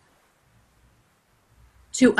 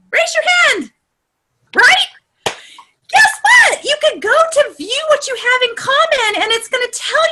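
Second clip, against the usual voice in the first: excited rallying; 11 semitones higher than usual. Real, not acted. Trainer urging the group to use her discovery, excited rallying. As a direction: call out excitedly to rally everyone.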